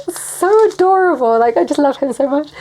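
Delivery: high, loud, giddy fondness; delighted squeal easing down; gushing adoration. Real, not acted. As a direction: coo it, overflowing with love. Gushes about loving Jesus.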